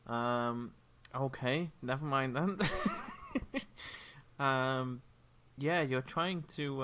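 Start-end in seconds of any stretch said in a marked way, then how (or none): 2.6-4.3 high-pitched trailing laugh. Real, not acted. Chuckles after dropping question.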